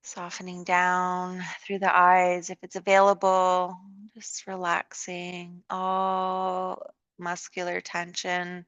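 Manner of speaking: calm, unhurried yoga guidance with long pauses; guided meditation. Real, not acted. Leads a yoga relaxation.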